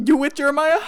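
mock shocked friend. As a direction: play the gossip twist for laughs. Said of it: "let's roleplay this".

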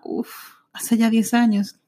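normal talking voice

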